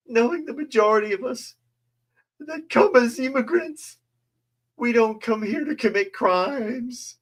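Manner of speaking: very high, quivering parody of tearful speech; mocking a weepy councilwoman in a very high voice; mock crying. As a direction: ham up a weepy plea. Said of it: "I should have done that in an accent".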